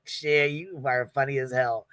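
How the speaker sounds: usual speaking voice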